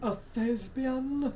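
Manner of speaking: high, slow, drawn-out question; mock-grand. Friend teasing about actors, high and mock-grand. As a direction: stretch it out in mock pretension.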